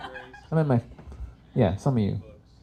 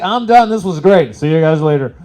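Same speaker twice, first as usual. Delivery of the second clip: breezy farewell; 4 semitones higher, 9 dB louder than usual. Pretend. Imagining the soul's cheerful farewell after its mission. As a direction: wave goodbye to life, unbothered. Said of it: "you'll be like".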